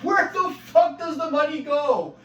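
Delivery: high, loud and slow, hitting WHERE. Retold or imitated. Pressing the boss again about the EI money, a high loud slow outraged yell. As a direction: drag out "Where the fuck" in outrage. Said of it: "I'm like".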